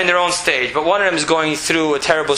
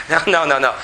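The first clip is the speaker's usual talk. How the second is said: normal talking voice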